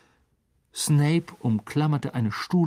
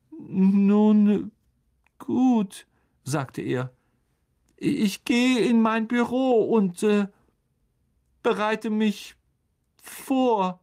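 higher and louder, slow; forced bravado; 10 semitones higher, 5 dB louder, much slower than usual. A narrator's reading. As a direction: pretend courage. Defeated Lockhart.

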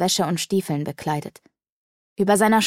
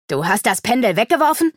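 louder, higher, indignant disbelief; furious accusing question; upset outcry; 5 semitones higher, 5 dB louder than usual. A narrator's reading. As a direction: cry out in outrage at the thrown-away pendulum. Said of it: "rief Sarana aufgebracht".

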